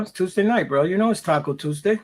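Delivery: ordinary talk, nothing marked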